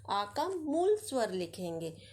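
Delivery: ordinary delivery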